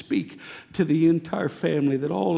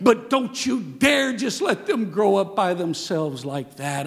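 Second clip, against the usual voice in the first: ordinary talk, nothing marked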